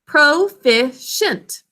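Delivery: slow, syllable by syllable; slow choral model. Shown as a demonstration. Teacher slowly models the word for students to repeat. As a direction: stretch out each syllable for the class. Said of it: "Can you all say it with me?".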